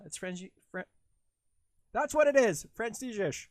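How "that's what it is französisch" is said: loud, high burst of realization; excited shout. Real, not acted. Streamer cracking the word finally, excited shout. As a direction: yell it out as it finally clicks.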